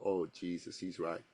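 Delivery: low, soft aside to yourself; quiet retold dismay; sinking realisation. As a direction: drop to a hushed, low admission.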